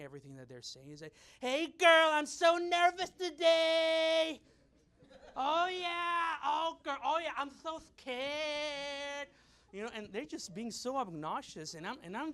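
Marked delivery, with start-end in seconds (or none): 1.4-4.4 put-on high, loud screechy girl talk, drawn out; mock screaming. Loud ladies chatting in the consulate line, screaming. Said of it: "so they start screaming". 5.4-9.3 put-on high screechy girlfriend reply, very drawn out; mock screaming. Lady answering her friend in line, screaming. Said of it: "so they start screaming".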